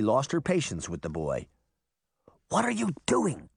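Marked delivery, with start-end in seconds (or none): none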